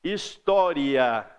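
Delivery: "História" slowly, the final "ia" gliding together; slow teaching demo of "História"; drawn-out example word. Shown as a demonstration. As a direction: stretch "História" to show its diphthong. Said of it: "Vamos ver exemplo aí".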